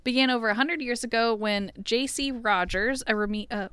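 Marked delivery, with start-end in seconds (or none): none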